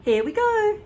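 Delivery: put-on excited boy cry. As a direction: call out with glee. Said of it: "George cried out".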